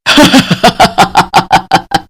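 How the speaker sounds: eerie evil cackle; evil ghost laugh. Acted. Ghost queen laughs after threat.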